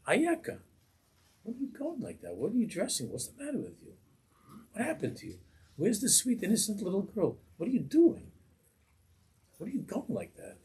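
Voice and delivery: high, dismayed scolding of a daughter; a parent upset at his daughter's clothes, high dismayed scolding. Retold or imitated. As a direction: play the shocked parent asking what happened to her.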